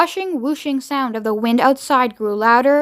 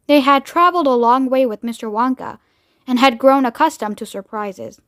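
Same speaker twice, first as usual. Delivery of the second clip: usual speaking voice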